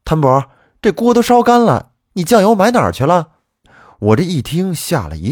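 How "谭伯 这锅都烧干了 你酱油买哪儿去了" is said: loud, high-pitched angry holler; exasperated mom shouting, pitched way up; mother's scolding yell. A narrator's reading. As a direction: yell after your dawdling kid. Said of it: "在我后面喊我说".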